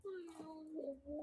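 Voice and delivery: very soft sulky no; whispered refusal. Real, not acted. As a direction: sulk it in a whisper. (pabulong na pagtanggi)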